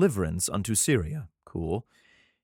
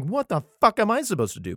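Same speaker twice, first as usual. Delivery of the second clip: mock panicked king; 10 semitones higher, 3 dB louder than usual. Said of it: "being like".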